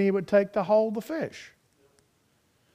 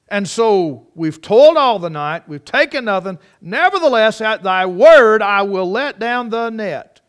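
louder, slowed, emphatic declaration; rising preaching; 3 semitones higher, 10 dB louder than usual. Real, not acted. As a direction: build it up and land each word. Sermon builds to climax.

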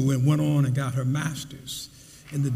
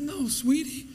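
mother's tender voice; 9 semitones higher than usual. Retold or imitated. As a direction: reassure her like a loving mother. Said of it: "She said".

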